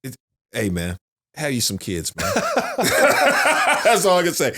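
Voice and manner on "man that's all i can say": high, louder laugh spilling into the words. Real, not acted. Dad laughing to a friend about raising kids. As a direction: crack up as you say it.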